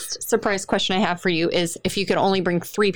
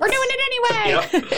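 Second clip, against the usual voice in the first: high laughing defiance; laughing tease. Real, not acted. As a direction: laugh it off, insisting. Teasing, laughing, high pitch.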